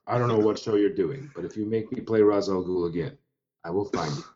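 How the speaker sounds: low, quiet movie-threat parody; Liam Neeson. Acted. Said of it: "he's Liam fucking Neeson".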